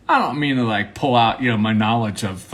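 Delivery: ordinary talk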